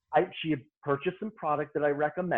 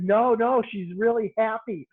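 eager reassurance; 7 semitones higher, 5 dB louder than usual. Retold or imitated. Reassuring the boss.

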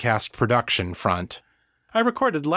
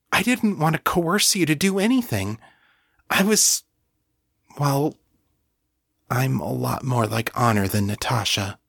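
put-on a higher, anxious young woman's voice; Kashani, flustered; 4 semitones higher than usual. Kashani protesting she never meant to coerce John, higher and flustered. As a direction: lift the voice into a flustered, apologetic Kashani.

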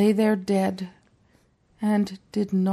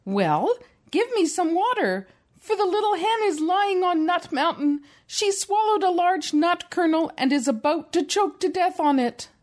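higher, urgent little rooster voice; little rooster pleading; 9 semitones higher than usual. A narrator's reading. Mom voicing the rooster asking the well, higher and urgent. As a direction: rooster begging the well for water. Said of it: "and said".

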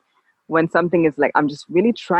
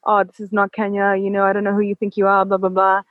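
lightly voiced annoyed guys, higher and faster; mock indignant locals; 4 semitones higher than usual. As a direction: imitate their huffy protest, a bit high and quick. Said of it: "they were like".

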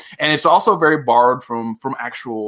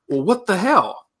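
baffled reaction; 8 semitones higher than usual. Acted. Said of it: "and you're kinda like".

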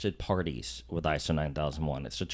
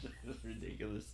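laughing; 4 semitones higher, 9 dB quieter than usual. Real, not acted. Laughing at the failed take.

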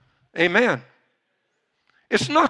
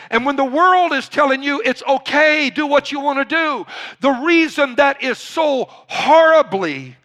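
raised, slow, emphatic sermon delivery; full-force preaching; 8 semitones higher, 4 dB louder than usual. Real, not acted. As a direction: preach it hard, drawing out the key words.

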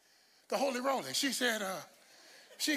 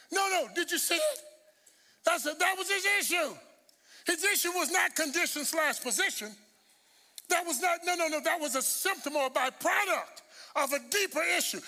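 raised, urgent pulpit voice; emphatic preaching; 5 semitones higher than usual. Real, not acted. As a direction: press the point on the congregation.

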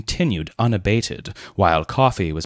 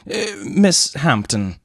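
solemn; 10 semitones higher than usual. A narrator's reading. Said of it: "began Leonard with increased solemnity".

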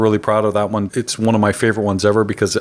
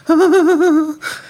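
high laugh; 4 dB louder than usual. Real, not acted. Laughing at the story.

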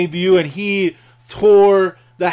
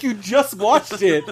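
snarky; 4 semitones higher, slower than usual. Retold or imitated. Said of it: "she's like".

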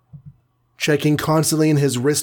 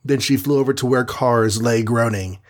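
plain reading voice

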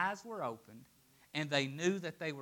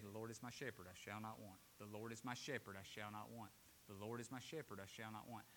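much softer and lower, repeating it to himself; murmured scripture; 7 semitones lower, 18 dB quieter, faster than usual. Shown as a demonstration. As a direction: murmur the verse over and over as if lying in bed. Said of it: "a scripture to roll over in my mind".

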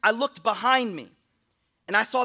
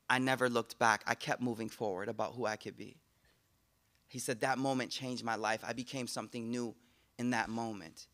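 much lower and softer; hushed solemn; 8 semitones lower, 7 dB quieter than usual. Retold or imitated. Repentant man recounting his awakening, hushed and solemn. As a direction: let the change sink in quietly.

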